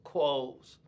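lightly voiced disappointed grandson; a hint of a let-down little boy; grandson unimpressed. Grandpa imitating grandson finding clothes, mildly deflated. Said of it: "he goes".

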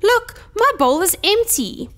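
high, thin little bear voice; squeaky Baby Bear complaint; tiny squeak. A narrator's reading. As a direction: pipe up like an upset bear cub. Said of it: "squeaked Baby Bear in his thin, tiny voice".

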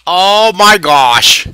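high, loud, stretched-out outburst; loud exclamation. Real, not acted. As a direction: cry it out slowly, each word drawn long. Exclaims over tangled drawing.

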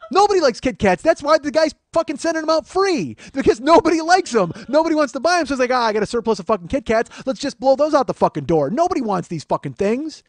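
high, loud exasperated tirade; angry comic rant. Acted. Man ranting about the seller's free Kit Kats, angrily loud.

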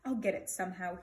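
plain reading voice

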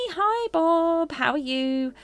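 cutesy greeting. Acted. Greeting the summoned pet.

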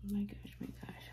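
hushed excited whisper; soft whispered wonder; awed whisper. Real, not acted. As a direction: whisper your delight. Awed over the doll.

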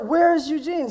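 eager, high-pitched question; bursting to find his friend; excited. Retold or imitated. Man asking the wife where his friend is, excited. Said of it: "I was so excited".

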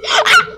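sharp breathless gasp; startled gasp. Real, not acted. Gamer spotting a guy in the dark, gasping. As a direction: suck in air in sudden fright. Spots the killer.

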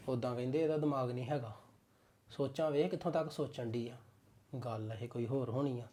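man grumbling to himself about wife; muttered aside. Acted. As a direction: mutter it under your breath.

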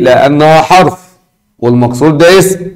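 ordinary talk

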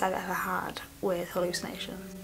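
fading to a whisper. Real, not acted. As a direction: let the words die into a whisper. Trails off, shaken by memory.

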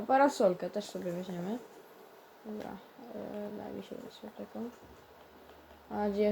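ordinary talk

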